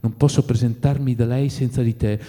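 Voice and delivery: ordinary delivery, nothing marked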